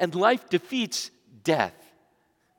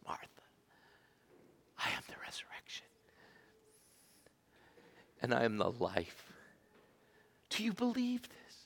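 whispered, much lower and softer; hushed whisper; 6 semitones lower, 11 dB quieter than usual. Acted. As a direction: lean in and whisper it. Jesus speaks to Martha, hushed.

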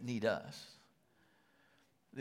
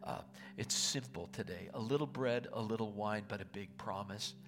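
prayer; 4 dB quieter than usual. Real, not acted. Pastor praying over the congregation at Thanksgiving, hushed and earnest. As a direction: pray it softly and sincerely over the people.

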